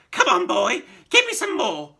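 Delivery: put-on high, loud screechy old woman; greedy old grandma yelling down; yelling crone. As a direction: demand it in a shriek. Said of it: "she yelled".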